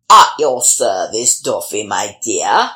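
oily devil's greeting, low. Acted. The devil appears and speaks.

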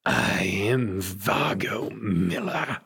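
slow, grand vampire declaration; imperious vampire villain. Acted. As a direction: announce the name with drawn-out menace. Game master voices vampire villain Miller.

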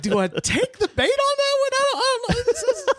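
very high, half-laughing mock inner monologue; wary inner thought. Host deciding whether to answer his cohost's joke, high and laughing. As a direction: squeak it out like you're dodging the bait. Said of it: "I was like".

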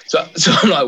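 normal talking voice

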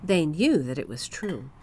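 ordinary delivery, nothing marked

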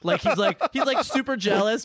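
usual speaking voice

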